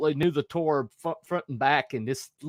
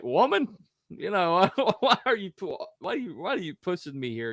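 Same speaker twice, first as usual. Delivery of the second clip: higher-pitched, half-laughing exasperation; mock protest; 6 semitones higher than usual. Son to his pushy mother, mock exasperation. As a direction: protest playfully, starting high.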